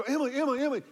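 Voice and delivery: high, loud calling of the name; urgent calling. Retold or imitated. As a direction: rouse the screaming child. Waking screaming daughter.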